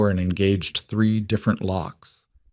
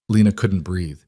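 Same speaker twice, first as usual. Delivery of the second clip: ordinary delivery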